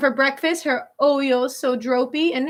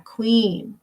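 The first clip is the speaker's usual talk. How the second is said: ordinary delivery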